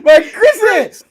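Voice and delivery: drawn-out name, laughing; slow laughing call. Acted. Reading chat aloud, calling the name laughing. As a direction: stretch the name through a laugh.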